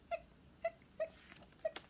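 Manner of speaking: high quiet hiccups; hiccup sounds. Acted. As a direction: squeak each hiccup lightly. Skeleton hiccuping.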